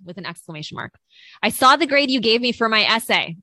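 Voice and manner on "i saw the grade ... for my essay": all-caps email read. Acted. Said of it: "imagine it being in all caps".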